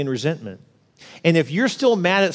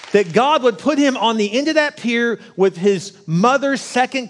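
higher, slow, impassioned preaching; fervent preaching; 5 semitones higher, slower than usual. Acted. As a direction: urge the crowd with conviction. Preaching at full force.

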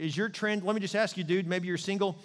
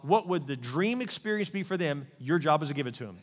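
normal talking voice

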